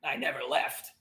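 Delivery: low grumble from below; grumbling. Acted. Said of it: "the voice grumbles at you".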